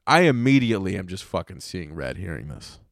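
ordinary delivery

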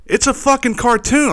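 high-pitched incredulous protest; exasperated disbelief. Real, not acted. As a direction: protest as if it's utterly absurd. Ranting about the resignation.

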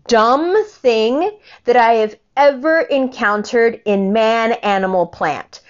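very slow, each word spaced out; slow emphatic list. Real, not acted. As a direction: hammer each insult separately.

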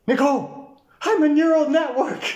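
high, loud squeaky shout; mock nerd voice. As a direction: shriek it like a nerdy robot. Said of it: "And you go".

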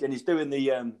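ordinary delivery

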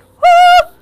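high, loud whoop of release; cheering woo. Retold or imitated. Podcaster cheering her Iron Man moment, loud whoop. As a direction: let out a big joyful whoop.

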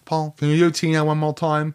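a hint of a Kiwi accent; light New Zealand accent put on. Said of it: "With your horrible New Zealand accent".

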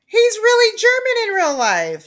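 high, loud interjection; loud protest. Real, not acted. Co-host defending the actor's German accent, loud protest. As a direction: jump in to object, voice shooting up.

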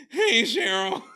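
loud, high put-on awkward voice; Jason's awkward voice. Joking guess at mute actor's voice, awkward and loud. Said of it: "does the actor have an awkward voice".

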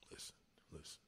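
hushed whisper to get attention. Real, not acted. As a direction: drop to a whisper and make them lean in. Hushing to regain attention.

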